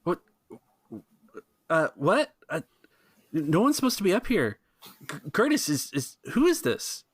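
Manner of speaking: high, baffled guard; confused guard. Acted.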